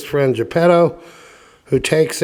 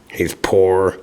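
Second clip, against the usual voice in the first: ordinary delivery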